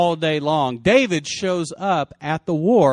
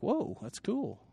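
hushed, higher-pitched acted awe; quietly impressed; 4 semitones higher, 12 dB quieter, slower than usual. Young David hearing about the reward, quietly impressed. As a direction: say it softly, as if wowed.

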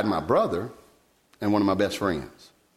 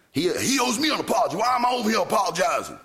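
much higher, raised, indignant cry; indignant outburst; 9 semitones higher, faster than usual. Retold or imitated. Son weeping in a parking lot, indignant raised cry. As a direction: protest it like the wronged son. Anguished outburst remembered in the car.

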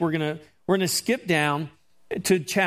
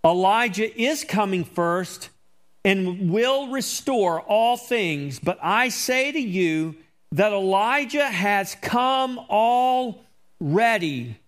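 slow, higher, rising preaching voice; emphatic scripture reading; 4 semitones higher, slower than usual. Acted. Pastor building to Jesus' answer about Elijah, slow and emphatic. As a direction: stretch out "already" like a revelation.

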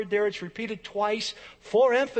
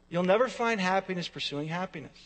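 ordinary delivery

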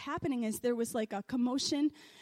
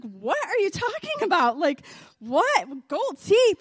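loud, swooping disbelief; retold bafflement, loud and high-pitched; incredulous; 4 semitones higher, 7 dB louder than usual. Daughter answering dad's news, loudly incredulous. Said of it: "I was like".

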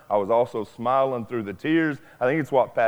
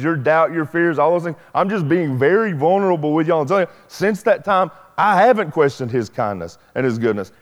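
rising, higher and louder preaching at full force; 6 semitones higher, 6 dB louder than usual. Acted. Pastor testifying to his church, preaching at full force. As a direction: build the sermon to a fervent peak.

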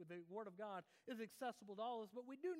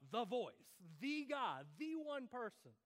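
"the" hit hard each time, as the one and only; stress on THE; 4 dB louder, slower than usual. Shown as a demonstration. Preacher explaining the definite article, emphatic THE. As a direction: punch "the" before "Voice", "guy" and "one". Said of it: "There's a difference between".

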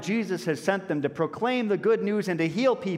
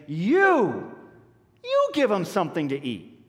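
"You" punched louder and higher; emphatic you; 4 semitones higher, 4 dB louder, slower than usual. Retold or imitated. Jesus looking the disciples in the eye, stressing "You". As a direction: hit "You" hard, eye to eye. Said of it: "there is emphatic".

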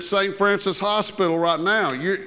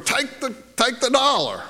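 mock parental yell; 6 semitones higher than usual. Parents urging their little girl from the back, mock yell. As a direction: imitate parents calling from the back. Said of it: "the parents yelled out".

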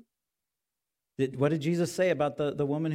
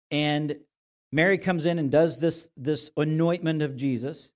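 normal talking voice

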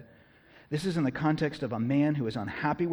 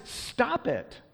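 mock indignation; 10 semitones higher than usual. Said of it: "He was mad".

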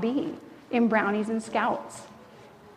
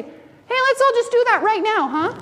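much higher, louder cheerful urging; rally everyone to help at once; bright, high can-do call; eager Don; 8 semitones higher, 5 dB louder than usual. Retold or imitated. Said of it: "And Don would say".

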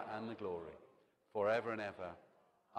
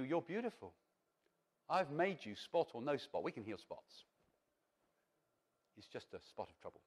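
much softer, gentle reassurance; tender voice of God; 5 semitones lower, 7 dB quieter than usual. Pretend. God comforting a worried believer, soft and tender. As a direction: speak like a loving father soothing a child. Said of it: "God would say to you".

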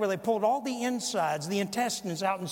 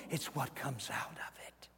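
hushed whisper; 6 semitones lower, 10 dB quieter than usual. Real, not acted. Preacher landing the point on what defiles, hushed whisper. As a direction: drop right down to a whisper.

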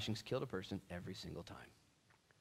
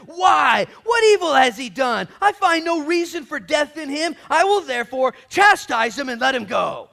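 high, loud protest to a crowd; forceful appeal; 20 dB louder than usual. Acted. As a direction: argue it at full voice. Pilate's third appeal to crowd.

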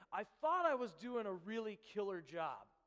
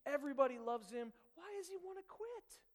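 retold dismay, climbing higher and lighter; baffled disbelief; 5 semitones higher, 4 dB louder than usual. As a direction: sound stunned that your best leader would quit. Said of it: "And I'm like".

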